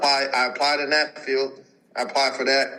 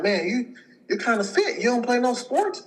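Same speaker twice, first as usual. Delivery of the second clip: higher mock patient voice; 10 semitones higher than usual. Patient voiced in retold story.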